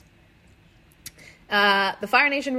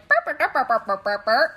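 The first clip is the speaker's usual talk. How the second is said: imitating a lemur's chittering; playful critter noises; mock Momo chirp; 3 semitones higher than usual. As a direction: chirp it like a confused pet. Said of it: "she's just like".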